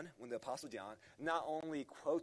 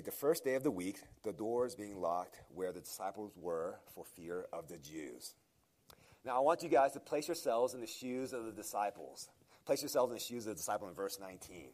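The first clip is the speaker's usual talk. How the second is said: ordinary talk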